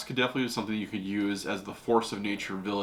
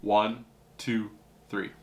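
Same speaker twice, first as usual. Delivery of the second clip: ordinary delivery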